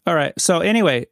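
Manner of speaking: ordinary talk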